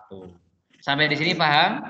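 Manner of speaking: normal talking voice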